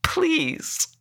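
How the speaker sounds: very high, tearful begging; crying. A narrator's reading. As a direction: wail the plea desperately. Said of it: "crying now".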